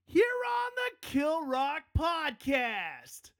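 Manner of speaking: slow, high, stretched announcer shout; hyped shout. Acted. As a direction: belt the show name like a hype man. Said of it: "show sign-off shout".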